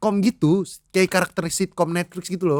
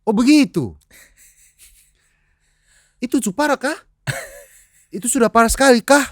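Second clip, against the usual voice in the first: high-pitched mock Ambonese accent; overdone Ambonese questioning; father's Ambonese accent; 8 semitones higher, 5 dB louder than usual. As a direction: imitate dad putting on Ambonese, rising and louder. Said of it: "Bokap gua".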